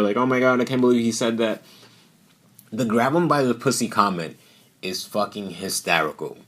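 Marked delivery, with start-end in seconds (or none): 0.2-1.6 higher, fast, pearl-clutching gasp; hammed-up outrage; mock offended. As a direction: play the shocked offended viewer. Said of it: "somebody who's offended".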